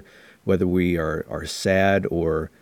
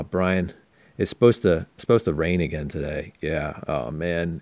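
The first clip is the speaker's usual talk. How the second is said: somber bad news; 2 semitones lower than usual. Shown as a demonstration. Breaking rainy forecast to Brian, somber and downbeat. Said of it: "bad news is typically delivered in a somber, more serious tone".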